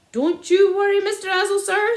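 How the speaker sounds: high, loud mock reassurance; cried out. A narrator's reading. Sergeant loudly pretending to help the landowner. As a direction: call out falsely helpful. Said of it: "Sergeant Samways cried out".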